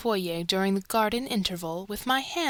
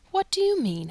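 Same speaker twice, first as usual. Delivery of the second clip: surprised question; 9 semitones higher, 3 dB louder than usual. A narrator's reading. Startled question.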